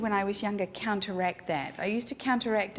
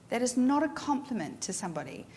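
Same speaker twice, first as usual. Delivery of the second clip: normal talking voice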